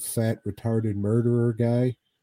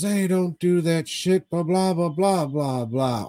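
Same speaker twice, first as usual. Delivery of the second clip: higher mock wife voice, scolding; higher hammed-up wife telling them to stop; imitating the wife; 7 semitones higher than usual. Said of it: "The wife comes out and says".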